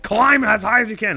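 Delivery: excited brain voice. Pretend. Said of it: "my poor Cobra brain took over and was like".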